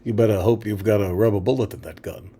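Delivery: very low, soft villain threat. Acted. Magneto warning someone with a gun, low soft voice. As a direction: improvise haltingly, deep and quiet. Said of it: "[name] as, as Magneto".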